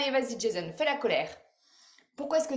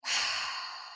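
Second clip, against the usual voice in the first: long, soft breathy sigh; slow audible out-breath; calming exhale; 6 dB quieter than usual. Shown as a demonstration. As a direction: demonstrate letting the breath out.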